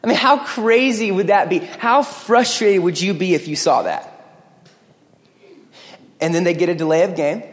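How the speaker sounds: ordinary talk, nothing marked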